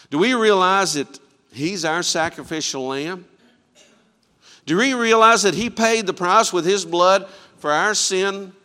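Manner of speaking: loud, high, urgent appeal; preaching at full force. Acted. Pastor pressing the congregation about their sin, loud and urgent. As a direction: drive the question home to the pews.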